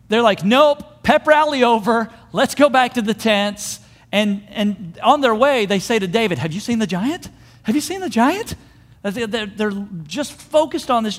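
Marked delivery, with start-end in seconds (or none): 0.4-3.8 loud, raised mock call-off; hasty retreat. Acts army abruptly backing out. 7.6-8.5 much higher, agitated repeat; agitated question. Acted.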